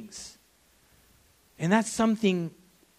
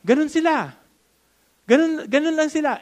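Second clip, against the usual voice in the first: higher and louder, insisting on it; louder, pointed assertion; emphatic loud; 9 semitones higher, 6 dB louder than usual. Real, not acted. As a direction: press it home to the crowd. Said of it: "Wala kayong alam na bata".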